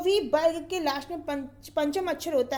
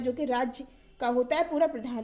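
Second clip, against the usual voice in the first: normal talking voice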